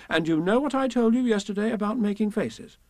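high, scolding mother; firm, swooping motherly telling-off; rather severe. Acted. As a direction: lecture a naughty child. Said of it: "said Kanga rather severely".

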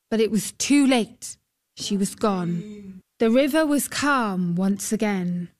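ordinary delivery

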